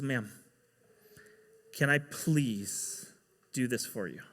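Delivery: quietly begging to be allowed; soft pleading request. Retold or imitated. Ramon, hands together, softly pleads to help her.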